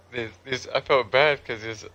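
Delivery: usual speaking voice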